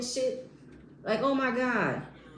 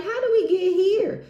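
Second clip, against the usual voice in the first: loud, high-pitched mock disbelief; exaggerated incredulous wail; exclaimed bafflement; 10 semitones higher, 7 dB louder than usual. Acting out own baffled reaction.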